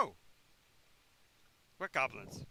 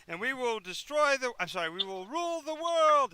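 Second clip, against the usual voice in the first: much higher, louder evil-ruler proclamation; villain declaration; 8 semitones higher, 10 dB louder than usual. Acted. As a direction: announce your conquest with glee.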